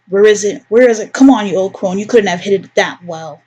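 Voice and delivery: usual speaking voice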